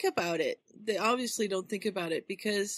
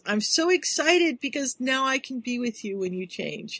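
higher-pitched excited retelling; eager, lifted quote; 5 semitones higher than usual. Retold or imitated. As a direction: gush it, voicing an excited girl. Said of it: "So she's all excited".

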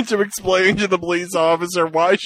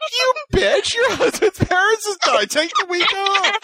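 much higher, teasing outraged voice; mock outrage. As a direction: scold her like a shocked friend, hammed-up. Mock scolding friend, teasing.

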